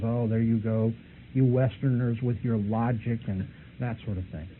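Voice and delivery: usual speaking voice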